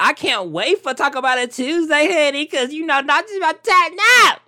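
high, loud hype-up yell; excited yelling. Acted. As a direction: build to a high, loud shout. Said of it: "if I'm yelling in your ear".

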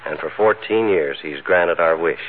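host signing off with gratitude; formal closing speech. Acted. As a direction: thank the audience warmly and slowly. Formal sign-off to audience.

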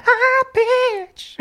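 squealed, stretched-out hair-band high note; high pitch. Shown as a demonstration. Co-host showing eighties hair band singing, high-pitched squeal. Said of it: "That's where you can get to the high".

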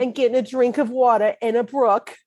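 high, put-on Brooklyn accent; Marissa Tomei Brooklyn accent. Quoting a film scene to co-host in Brooklyn accent. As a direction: set the scene in movie Brooklynese. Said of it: "talk in my Brooklyn accent".

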